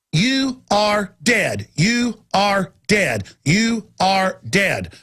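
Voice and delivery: each word spaced out, very slowly; grave slow repetition; slow, deliberate repeat. Real, not acted. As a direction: say it three times slowly, letting it sink in.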